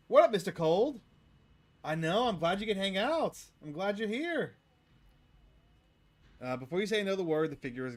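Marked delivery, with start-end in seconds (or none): 0.1-4.6 high, loud faux-friendly hello; mock cheerful greeting. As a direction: greet the villain with fake cheer.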